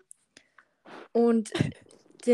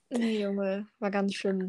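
ordinary talk

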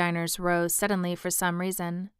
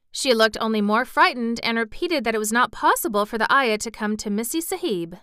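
usual speaking voice

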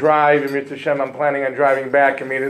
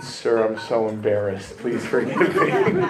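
sheepish apology; 4 semitones lower than usual. Retold or imitated. Sheepish apology after ignition question.

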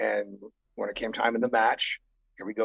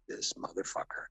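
under-breath whisper of annoyance; whispered thought; 10 dB quieter than usual. Retold or imitated. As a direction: mutter it privately, unvoiced. Inner thought at rude hero.

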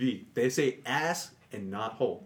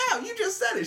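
mock protest. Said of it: "I'm like".

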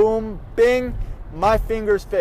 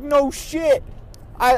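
usual speaking voice

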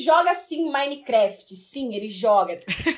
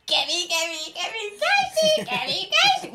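imitating the jingle; 9 semitones higher, slower than usual.

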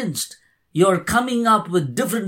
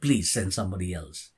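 meek plea; 10 semitones lower, 8 dB quieter, faster than usual. Retold or imitated. Moses begging to be excused.

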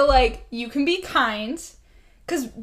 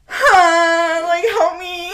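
mock plea; 5 semitones higher, 6 dB louder, much slower than usual. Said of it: "I was like".

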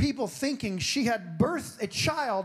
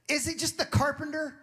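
dismissive; 9 semitones higher than usual. Retold or imitated. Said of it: "So they were already upset".

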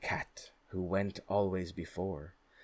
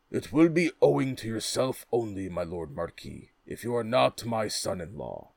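jovial king voice; 5 dB louder than usual. Acted. King speaks, after some wine.

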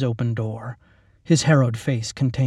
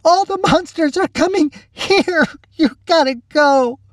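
very high, wailing desperation; sobbed warning; 5 dB louder than usual. A narrator's reading. Lacey telling the captain monsters are coming, sobbing wail. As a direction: wail it in panicked tears. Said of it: "Lacey sobbed".

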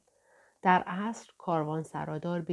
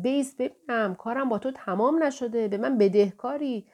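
high, loud call at someone walking away; shouting after him; 6 semitones higher, 5 dB louder than usual. A narrator's reading. Innkeeper shouting after the departing dervish, high and loud. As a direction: bellow it at his back, pitched high and loud. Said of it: "پشت سرم فریاد زد".

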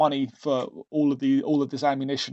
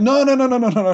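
loud, higher rush of no's; alarmed protest; 9 semitones higher, 6 dB louder, faster than usual. Retold or imitated. Stopping the driver's offer in a mock panicked loud protest. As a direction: hastily shout them down, mock panic.

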